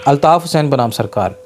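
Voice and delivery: ordinary talk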